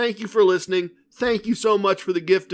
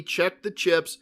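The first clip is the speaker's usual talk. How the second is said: ordinary delivery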